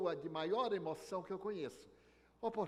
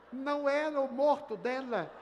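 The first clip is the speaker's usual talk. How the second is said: comic realization; 9 semitones higher, 8 dB louder than usual. A narrator's reading. Punchline of wrong corpse story.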